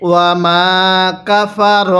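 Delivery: ওয়া মা কাফার in slow separate pieces, "ro" not "ra"; measured chant, holding the "maa"; teaching recitation. Shown as a demonstration. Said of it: "কিভাবে শিখব?". (ধীরে ভেঙে ভেঙে তিলাওয়াত, "রা" নয় "র")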